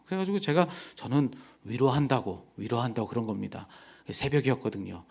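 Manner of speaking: ordinary delivery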